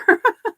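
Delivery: high, loud giggle; laugh. Real, not acted. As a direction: burst out giggling. Laughs at quilters bar quip.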